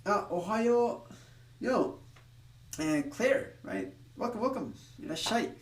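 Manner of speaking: lifted, friendly hello to a viewer; greeting Claire as she joins, cheerful and high; bright greeting. Real, not acted. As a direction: welcome the newcomer up high and cheerful. Greeting viewer Claire joining stream.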